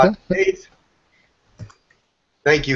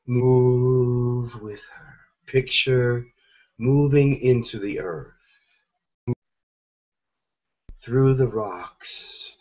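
guided meditation; 2 semitones lower, 5 dB quieter than usual. Acted. Leading listeners into Mother Gaia's heart, soft guided meditation. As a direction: guide them gently inward, softly and unhurried.

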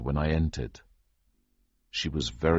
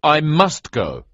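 louder, higher, slow insistence; arguing; 10 semitones higher, 7 dB louder, slower than usual. A narrator's reading. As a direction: push back against the colonel. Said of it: "I argued".

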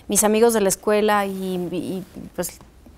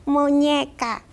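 put-on toddler answering; 3 semitones higher, 4 dB quieter, much slower than usual. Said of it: "yo le digo".

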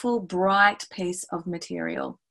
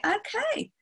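high, lilting classroom voice; bright-eyed questioning of young pupils; teacher to children; 7 semitones higher than usual. Acted. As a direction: pitch it up playfully for small children.

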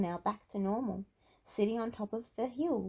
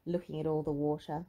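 ordinary delivery

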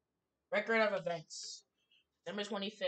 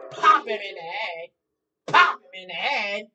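loud shouted chant; shouting; 17 dB louder than usual. Real, not acted. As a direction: shout it, hyped up. Shouting repeated line.

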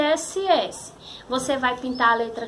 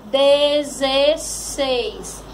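"Dezesseis" very drawn out, each syllable clear; palavra bem esticada; much slower than usual. Shown as a demonstration. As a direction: stretch every syllable of "Dezesseis". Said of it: "Formou que palavrinha?". ("Dezesseis" muito devagar, bem pronunciado)

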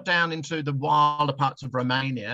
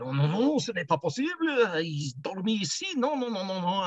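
higher, insistent French protest; protesting Frenchman; 7 semitones higher than usual. Retold or imitated. Second Frenchman refusing the camping spot, higher insistent French protest. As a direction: wag a finger in French, higher. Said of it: "Another Frenchman came down and said".

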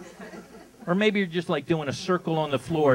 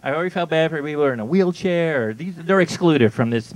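ordinary talk, nothing marked

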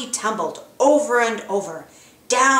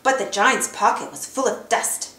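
usual speaking voice

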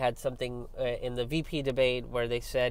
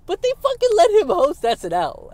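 much higher, loud indignant outburst; exasperated outburst; 9 dB louder than usual. Real, not acted. Commentator fuming about Trump hosting the show, exasperated outburst. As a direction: raise your voice in real disbelief, pitch shooting up. Outraged they let Trump host.

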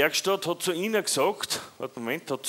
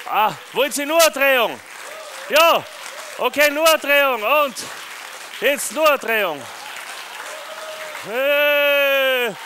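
excited showman; 8 semitones higher, 3 dB louder, slower than usual. Real, not acted. Excited showman talking to audience.

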